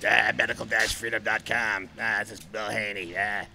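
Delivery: lightly put-on low host voice with drawn-out ah; imitating his slow announcer voice, ah before each phrase; mock radio host voice. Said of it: "why are you talking like that? Why don't you talk like".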